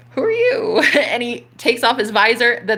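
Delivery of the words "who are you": high bright who are you; puzzled question. Retold or imitated. Meeting helmeted stranger at the door, high puzzled. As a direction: pitch way up, confused at a stranger. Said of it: "And I was like".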